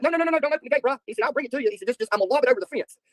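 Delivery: imitated high, frantic refusal; panicked pleading. Delivery driver begging to keep gate shut, high mock panic. Acting out driver afraid of dog.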